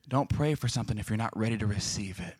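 soft, low, grave delivery; hushed repeat. Real, not acted. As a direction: drop it low so it sinks in. Same line repeated for solemn emphasis.

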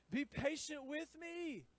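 slow, high pleading from a kneeling servant; begging servant. A narrator's reading. Servant on his knees pleading with his master, high and slow. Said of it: "he begged".

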